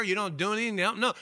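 ordinary talk, nothing marked

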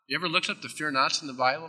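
usual speaking voice

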